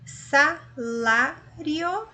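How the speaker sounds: Salário said very slowly, syllable by syllable; teacher sounding out Salário; slow syllable demo. Shown as a demonstration. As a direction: draw out every syllable of Salário. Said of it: "eu tenho um ditongo aqui".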